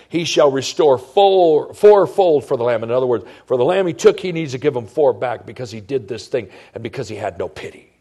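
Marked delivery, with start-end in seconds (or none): 0.1-2.8 loud, slow, enraged king's sentence; an angry king pronouncing sentence; furious verdict. Acted. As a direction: thunder out the judgment on the thief. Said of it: "And he said".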